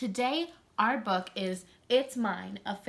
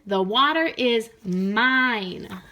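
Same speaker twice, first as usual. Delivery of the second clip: possessive shout; 11 dB louder, much slower than usual. Acted. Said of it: "yelled Milton".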